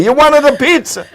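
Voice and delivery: high-pitched mock Italian; feed a line in an Italian accent. Shown as a demonstration. Host gives comedian a line to copy, Italian accent. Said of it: "Who wanted to say?".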